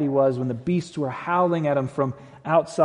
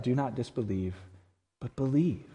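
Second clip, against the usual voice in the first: soft, lower and unforced; intentionally soft; 3 semitones lower, 6 dB quieter than usual. Acted. Jesus answering doubting Thomas, softly. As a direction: say it softly, as a gentle invitation. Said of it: "I read that last phrase intentionally soft".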